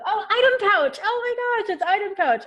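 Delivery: high, excited greeting of a friend; delighted surprise. Real, not acted. Spots a friend arriving in chat.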